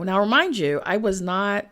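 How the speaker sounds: ordinary talk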